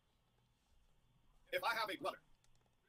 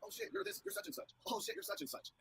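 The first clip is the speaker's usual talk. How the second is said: mock starstruck fan; 13 semitones higher than usual. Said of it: "Raja's like".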